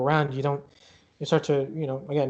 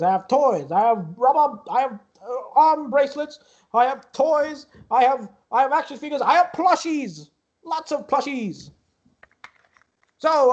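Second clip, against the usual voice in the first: higher-pitched carnival barker pitching his wares; showy carnival ticket-booth boss; 10 semitones higher than usual. Acted. Booth boss tells visitors about his plushies and magical items, showy. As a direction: hawk the prizes with theatrical flourish, softening at the end.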